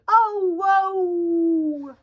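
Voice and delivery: high, drawn-out howl; wolf howl. Acted. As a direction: let the howl ring out long. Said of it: "Every night he howls at the moon".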